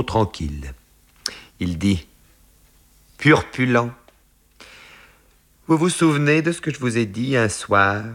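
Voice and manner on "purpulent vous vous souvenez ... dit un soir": slightly raised, probing voice of an employer; lightly voiced older boss prompting a memory; Chambernac questioning. A narrator's reading. Boss reminding his secretary of an evening, lightly voiced questioning.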